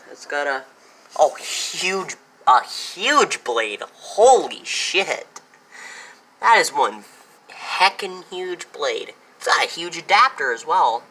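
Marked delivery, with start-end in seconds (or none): 1.2-11.0 loud, drawn-out amazement at the size; real wowed outburst, stretching the key words; amazed. Real, not acted. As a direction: exclaim it slowly, higher and louder, really impressed. Amazed at finding a huge blade.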